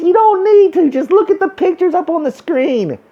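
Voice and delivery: high-pitched, dopey reassurance; sarcastic coaxing of a simpleton; mock pandering. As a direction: talk down in a silly high voice. Mocking studio talking down to fans.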